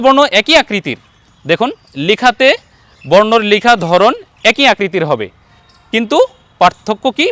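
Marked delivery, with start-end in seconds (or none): none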